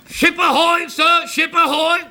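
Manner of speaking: high shouted ship ahoy from the crow's nest; lookout's call. Acted. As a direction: call it out from up the mast.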